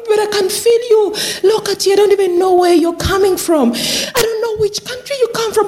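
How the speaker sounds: high, moved outpouring of thanks; emotional gratitude. Retold or imitated. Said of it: "she was quite getting teary".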